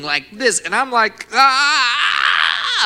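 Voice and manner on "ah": loud high cry of pain; yell of pain. Retold or imitated. Man retelling his shoulder popping out, pained yell. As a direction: yell out in agony, pitched way up. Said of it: "But I'm yelling".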